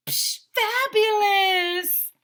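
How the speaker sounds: high, loud, very slow fabulous; hammed-up diva photographer; drawn-out fabulous. A narrator's reading. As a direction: stretch it out like a diva. Miss Joni's big finish.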